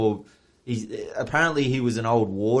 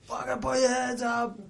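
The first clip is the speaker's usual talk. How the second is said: high, held-back shout; mock distant yell; 11 semitones higher, 7 dB quieter than usual. Police order two young men from a car, imitated yelling. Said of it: "like, yelling at us".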